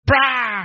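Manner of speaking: loud, high shouted crash of thunder; explosive mimicked bang; thunder crack imitation. Shown as a demonstration. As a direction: burst out like a thunderclap. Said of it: "ele não faz assim".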